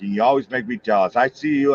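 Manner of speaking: ordinary delivery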